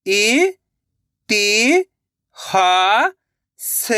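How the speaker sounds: "इ-ति-हा-स" very slowly, syllables apart; sounding out slowly. Shown as a demonstration. Teacher showing children how to sound out a word. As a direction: sound out "इ-ति-हा-स" piece by piece. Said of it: "एक एक अक्षर का उच्चारण करके".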